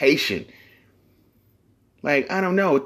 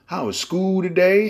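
mock parent voice; 8 semitones higher than usual. Mock parent asking kid.

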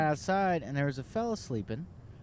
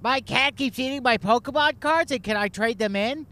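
higher and louder, no volume control; loud nerdy customer; 9 semitones higher, 7 dB louder than usual. Retold or imitated. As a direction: blurt it out like an overexcited fan. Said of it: "Just a guy was like".

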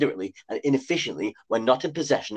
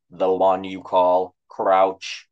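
robot voice; 4 semitones lower, much slower than usual. A narrator's reading. Robot character voice.

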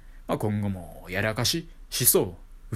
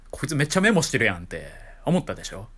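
ordinary talk

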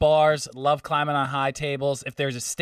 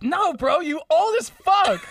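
high, slow laughing mock shout; hammed-up bouncer shouting high and slow through a laugh; drawn-out high mock yell, laughing; 12 semitones higher, slower than usual. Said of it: "And he's like".

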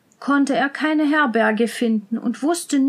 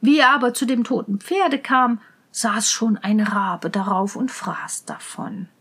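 ordinary delivery

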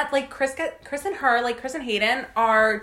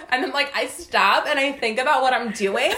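ordinary talk

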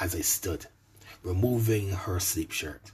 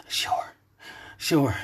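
gasped; 5 semitones higher than usual. A narrator's reading. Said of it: "She gasped out".

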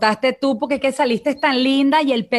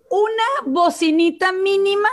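tiny little voice; 7 semitones higher than usual. Retold or imitated. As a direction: stretch it out high, like a tiny speaker. Mimics a tiny phone speaker. (vocecita aguda y alargada)

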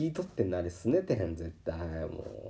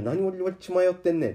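higher, louder self-rebuke; scolding himself; 8 semitones higher, 6 dB louder than usual. Retold or imitated. Tempted by a high price, he scolds himself aloud. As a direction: snap at yourself to wake up. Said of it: "って思いながらも".

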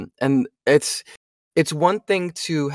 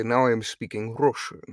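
softer, thick Russian-accented English; 3 semitones lower, 4 dB quieter than usual. Shown as a demonstration. Voice actor showing his warm-up line for Russian, Russian accent. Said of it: "or if it's Russian, I have to say".

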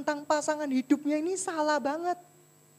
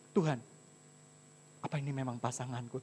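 low, soft retold plea; hushed doubting prayer; 11 semitones lower, 10 dB quieter, faster than usual. Husband asking God about his wife, hushed and doubtful. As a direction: murmur a doubtful question to God.

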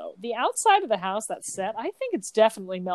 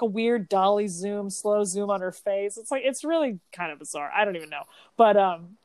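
normal talking voice

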